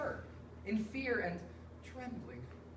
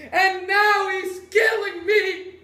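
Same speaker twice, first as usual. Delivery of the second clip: loud, high, slow near-sung lament; keen it like a grieving lament; anguished wail; 4 semitones higher, 6 dB louder, much slower than usual. Acted. Master laments to the guests over his old servant, slow wailing half-sung cry.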